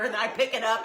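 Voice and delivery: normal talking voice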